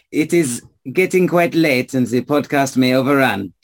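put-on French-accented hurry-along. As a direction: move them on with a French accent. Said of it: "You speak in weird accents".

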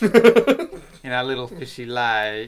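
silly drawn-out voice. Pretend. Playful silly drawl on fishy lake.

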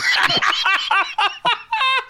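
big high burst of laughter; hearty laugh. Real, not acted. Guest laughs at own story.

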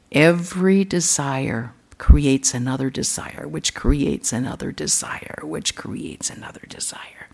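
a fading, whispered chant of desire; fading repetition. Acted. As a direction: let desire echo away into a whisper. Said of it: "repeating, fading away".

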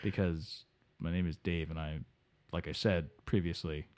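muttering dim guest; Delaware Dave. Acted. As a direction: drift in like a sleepy guest. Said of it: "Delaware Dave repeats".

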